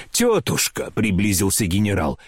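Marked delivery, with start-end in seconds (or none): 0.1-0.9 high, pleading call; trembling plea. A narrator's reading. General approaching his aunt, high and anxiously pleading. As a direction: appeal to her nervously, pitch well up. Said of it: "Голос у него дрожал и падал".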